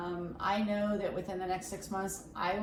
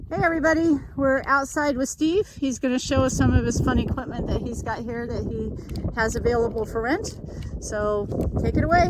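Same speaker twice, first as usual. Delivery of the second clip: loud, upbeat outdoor welcome; loud host intro; 4 semitones higher, 10 dB louder than usual. Real, not acted. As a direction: call out to viewers outdoors.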